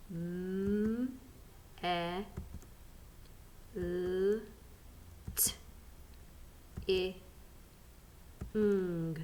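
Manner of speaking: M, E, L, T, I, ng as isolated sounds, long pauses between; sounding out. Shown as a demonstration. Teacher readies learners to blend, sounding out each sound. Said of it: "Right, ready?".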